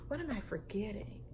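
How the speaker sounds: usual speaking voice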